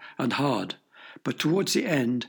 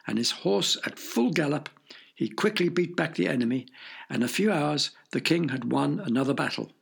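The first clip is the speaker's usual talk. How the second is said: usual speaking voice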